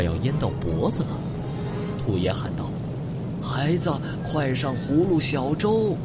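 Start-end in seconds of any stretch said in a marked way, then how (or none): 3.4-6.0 calling out. Acted.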